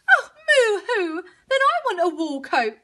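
high moo-hoo whine; tearful high cow voice; crying cow. A narrator's reading. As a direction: wail then beg as a spoilt cow. Cow cries for a coat.

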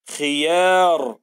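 خيار very slow, the ya held long; stretched خيار. Shown as a demonstration. Teacher reading خيار with a long, very slow vowel. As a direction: drag out the a in خيار. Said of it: "طولنا في صوت الياء".

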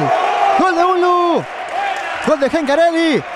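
shouted goal call. Acted. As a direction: belt out the goal. Goal called in commentary.

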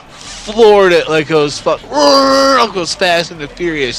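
excited. Real, not acted. Retelling the wild drive.

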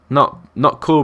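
ordinary talk, nothing marked

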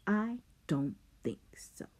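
whispered taunt; menacing whisper. Acted. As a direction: breathe it right at him. Simon taunting Rob closely.

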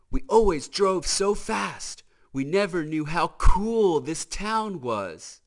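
DJ's voice. Acted. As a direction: marvel like a laid-back hot rod.

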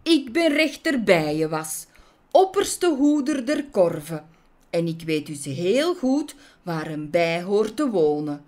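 loud, stern proclamation; indignant official rebuking an offender; self-important judge. Acted. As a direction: announce your rank, then lay down the law. Judge pompously rebukes Maya.

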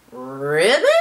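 low croak sliding up into a question; confused ribbit. A narrator's reading. Little pig left alone on rock, confused croak. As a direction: croak it uncertainly. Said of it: "sounds like he's a little confused".

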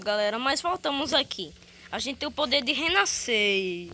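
normal talking voice

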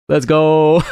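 high-pitched celebratory shout-out; gleeful whoop; excited cheer. Real, not acted. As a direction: cheer your second chance. Celebrates another chance.

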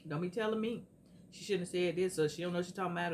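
ordinary talk

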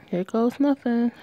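ordinary talk, nothing marked

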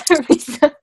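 giggling through the name; laughing. Pretend. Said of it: "repeats the name laughing".

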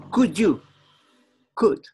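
could 说成 /kud/，L 不发音; could without L. Shown as a demonstration. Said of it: "No se pronuncia la L".

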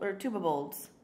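ordinary delivery

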